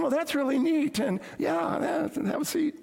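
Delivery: high-pitched mock nervous chatter; really nervous. Nervous student welcomes the football player, high-pitched and flustered.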